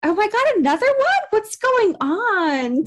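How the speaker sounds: high, stretched-out mock disbelief; retold shock. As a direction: play her stunned reaction, drawn out. Retold shocked friend's reaction.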